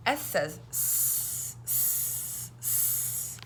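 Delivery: S says s, slow, the s hissed unvoiced; slow letter sound. Shown as a demonstration. Teacher modelling the s sound slowly for kids. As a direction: stretch and hiss the s sounds.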